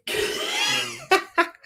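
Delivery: sudden high squealing laugh burst; high shrieking laugh. Real, not acted. Laughing at calling the book rubbish, high shriek. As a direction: shriek of laughter at your own joke.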